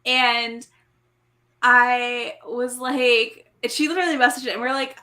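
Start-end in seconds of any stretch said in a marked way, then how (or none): none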